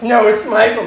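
firm correction. Retold or imitated. Haunt actor corrects the woman's name, insistent.

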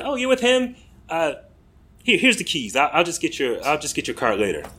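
starts very high and comes down, an easygoing imitated Irish accent; a relaxed Irish landlord in an American's Irish accent; offhand imitated Irish accent handing over the keys; Irish accent attempt. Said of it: "my attempts to do the accent".

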